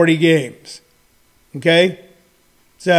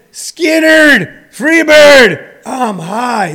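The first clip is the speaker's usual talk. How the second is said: put-on high, loud drunken hollering, dropping at the end; wasted partygoer; 7 semitones higher, 5 dB louder, much slower than usual. Comedian imitating wasted partygoers in the backwoods, drunken holler. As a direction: holler like a hammed-up stoned rock fan. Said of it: "only phrases after about three hours".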